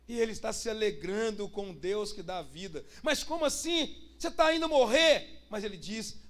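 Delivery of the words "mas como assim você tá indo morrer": high-pitched disbelieving question, getting louder; acted astonished protest; incredulous. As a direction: challenge him in raised, astonished voice. Acting disbelief at condemned Paul rejoicing.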